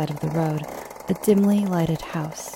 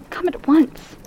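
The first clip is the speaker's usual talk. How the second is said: much higher, urgent woman's plea; anxious woman begging for help; agitated woman; 10 semitones higher, 4 dB louder, slower than usual. Acted. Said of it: "in an agitated voice".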